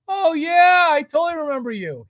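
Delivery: high, loud, rushed gush of recognition; big phony greeting, blurted high; fake enthusiastic recognition. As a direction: overdo the delighted "of course I know you". Acting manager pretending to know caller.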